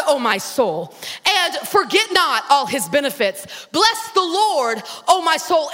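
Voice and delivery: loud, slow preaching to your own soul; preaching to himself. Retold or imitated. David exhorting his own soul, preaching loud. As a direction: command your soul to bless the Lord. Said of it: "He said".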